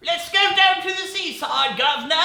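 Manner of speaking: very high, loud, slow shouted cockney-posh voice; hammed-up shouting English accent; over-the-top British accent. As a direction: belt it like a cartoon Brit. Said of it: "my instinct was to go".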